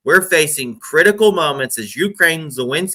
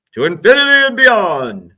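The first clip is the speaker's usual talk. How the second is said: Buzz Lightyear catchphrase; 7 semitones higher, 6 dB louder than usual. Pretend. Catchphrase quote at sign-off.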